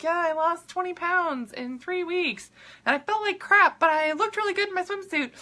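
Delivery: high-pitched mock dieter voice; boasting crash dieter. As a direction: imitate a dieter bragging, way up high.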